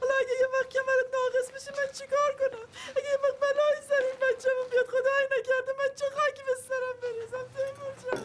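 tearful lament. Acted. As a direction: whimper the worry through tears. Wife frets over injured husband. (گلایه با گریه و صدای زیرتر)

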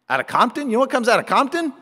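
high, incredulous rising questions; scoffing disbelief. Acted. Most people reacting to good from Compton, incredulous. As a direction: question it quickly as if it's absurd.

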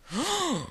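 high, breathy gasp; sharp gasp. Real, not acted. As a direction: gasp in surprise. Sudden gasp.

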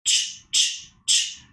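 ch ch ch whispered as a repeated sound; sounding out words. Shown as a demonstration. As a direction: hiss ch, ch, ch softly, one sound at a time. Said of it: "talk about it".

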